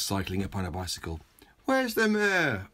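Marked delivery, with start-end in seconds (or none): 1.6-2.7 a worried official calling out; official asking. Acted. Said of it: "asked the official".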